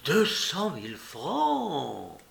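slow, drawn-out amazed exclamation; exclaiming. A narrator's reading. La mère, apprenant l'héritage, s'exclame, éblouie. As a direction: savour each word of the sum in awe. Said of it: "Madame Jujube s'exclama".

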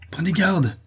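high, threatening cry; worked-up, high-pitched threat; furious warning. Acted. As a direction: warn them off in a fury. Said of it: "s'écria l'artisan furieux".

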